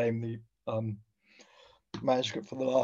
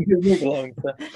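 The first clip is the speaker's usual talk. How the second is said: laughing, higher and louder; 5 semitones higher, 6 dB louder than usual. Retold or imitated. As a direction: echo it through a laugh. Echoing the quote, amused.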